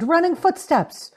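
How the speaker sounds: ordinary talk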